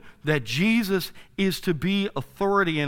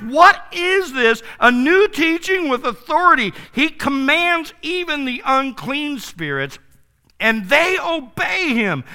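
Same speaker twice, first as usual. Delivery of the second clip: amazed crowd; 7 semitones higher, 5 dB louder than usual. Acted. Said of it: "and they asked".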